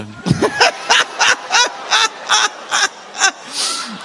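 big high whooping laugh; loud shouting laugh. Real, not acted. Preacher bursting into a loud whooping laugh.

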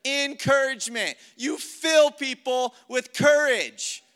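loud, high, drawn-out rallying delivery; forceful, emphatic preacher's charge; rousing encouragement. Shown as a demonstration. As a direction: fill them with courage as you say it. Contrasts soft word with rousing exhortation.

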